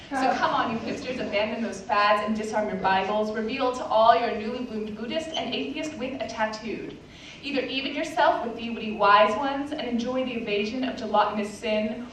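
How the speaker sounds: ordinary talk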